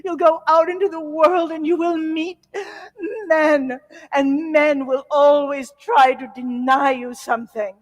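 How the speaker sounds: high, loud, tearful diva wail; dramatic mother. Retold or imitated. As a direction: play the mother as a melodramatic opera singer. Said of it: "she was very dramatic. My mother was an opera singer".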